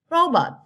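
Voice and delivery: Robot, RO pitched high, then falling; Robot with a high, strong RO; first-syllable stress. Shown as a demonstration. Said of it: "Vần một".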